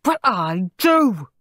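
slow, stressed cross comeback; irritated, drawn-out contradiction; snapped retort. A narrator's reading. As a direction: snap back, leaning on each word. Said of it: "snapped Henry".

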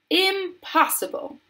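high, drawn-out exclamation; theatrical high-pitched protest; emphatic cry. A narrator's reading. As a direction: cry out in flat denial. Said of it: "cried Mister Wonka".

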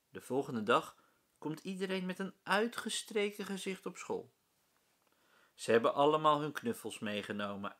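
usual speaking voice

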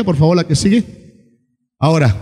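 normal talking voice

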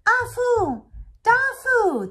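high, loud call from far off. Acted. Grandfather calling for the twins, loud and high. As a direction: call the lost kids across the forest. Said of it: "the voice cried".

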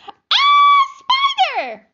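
piercing high shriek of fright; terrified scream. Acted. Family member shrieks on finding the spider.

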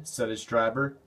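ordinary delivery, nothing marked

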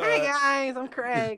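high, loud put-on greeting; high-pitched pretend pal saying hello; Craig. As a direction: introduce yourself as a silly high-voiced friend. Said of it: "me pretending to be Craig".

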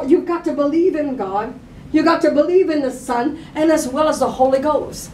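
usual speaking voice

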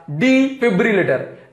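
Defibrillator spelled out slowly, syllable by syllable; slow, clear repetition of defibrillator; slow repeat. Shown as a demonstration. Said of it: "क्या?".

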